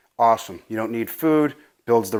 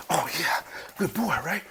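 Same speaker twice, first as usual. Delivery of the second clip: soft doggy praise; 3 semitones higher, 9 dB quieter than usual. Real, not acted. Praising the dog.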